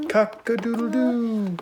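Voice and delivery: high crowing rooster. Pretend. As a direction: crow like a rooster.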